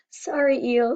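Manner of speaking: ordinary delivery, nothing marked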